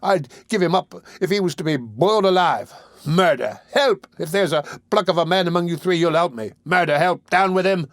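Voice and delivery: put-on excited boy shouting. A narrator's reading. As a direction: rouse the room, yell for help. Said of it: "becoming more and more excited as he spoke".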